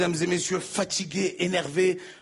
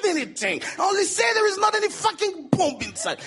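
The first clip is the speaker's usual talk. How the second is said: angry retort; 11 semitones higher than usual. Acted. Comic shouting back at the guard, high and angry. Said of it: "j'ai été énervé".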